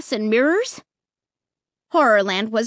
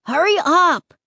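high, loud impatient call; impatient shout; 7 semitones higher, 5 dB louder than usual. Acted. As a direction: yell at her to hurry. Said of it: "Luke shouted impatiently".

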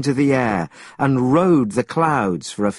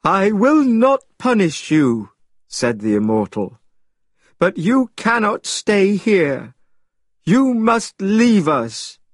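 stern immortal; 7 semitones higher than usual. A narrator's reading. Immortal's held voice.

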